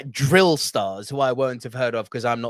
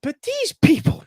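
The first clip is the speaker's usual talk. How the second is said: incredulous; slower than usual. Real, not acted. Exaggerated disbelief.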